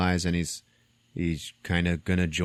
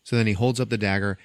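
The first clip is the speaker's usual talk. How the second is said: ordinary talk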